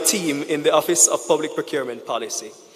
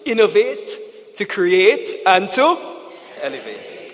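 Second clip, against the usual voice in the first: rising louder with each verb; building charge; 5 semitones higher, 5 dB louder than usual. Real, not acted. Speaker rallying delegates to innovate, building louder. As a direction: build it up like a call to action.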